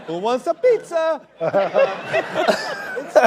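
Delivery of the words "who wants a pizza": loud, high cartoon Italian; sing-song hammed-up Italian accent; mock Italian accent. Host playing an Italian in English suburbs, mock Italian accent. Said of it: "I can do that accent".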